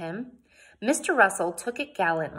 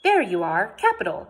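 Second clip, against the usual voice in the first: hearty announcement; 3 semitones higher, 8 dB louder than usual. A narrator's reading. Mister Swan finding Jane, announcing loudly. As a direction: hail her loudly and heartily. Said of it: "Mister Swan announced".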